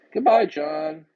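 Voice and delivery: loud, curt goodbye; loud dismissal. Real, not acted. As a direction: cut him off loudly. Host kicks caller off.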